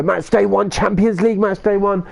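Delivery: excited. Real, not acted. Excited game announcement.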